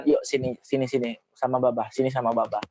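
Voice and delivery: put-on a father inviting his child close, tender; tender coaxing. Shown as a demonstration. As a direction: coax the child gently into your arms. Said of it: "memeluk atau memegang tangan".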